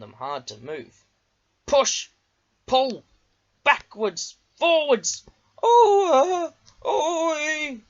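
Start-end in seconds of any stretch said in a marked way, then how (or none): 1.6-7.8 high, loud, strained truck voices; straining trucks heaving and complaining; groaning trucks. A narrator's reading. Trucks groaning as Diesel shoves them. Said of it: "the trucks groaned".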